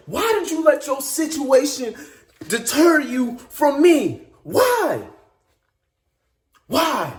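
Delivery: a pained Jesus asking why; Jesus rebuking. Acted. Jesus rebukes Peter, voiced in sermon.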